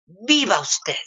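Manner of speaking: a hint of an eerie stranger; mock cryptic lady. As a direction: bless her like a knowing stranger on the way out. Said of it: "me dice".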